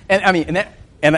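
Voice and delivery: ordinary delivery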